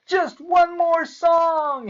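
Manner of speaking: high, slow pleading cry; cried out. A narrator's reading. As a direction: stretch it out, begging to stay. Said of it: "he cried".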